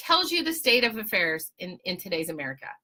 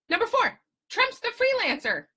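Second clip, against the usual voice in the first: mocking announcement; 11 semitones higher than usual. Mocking list reading.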